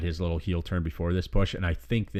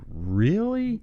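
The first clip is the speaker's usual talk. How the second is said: drawn-out, rising incredulous question; mock disbelief; 13 semitones higher than usual. Viewer fed up with endless rest holds, incredulous. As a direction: let it climb high in exasperated disbelief.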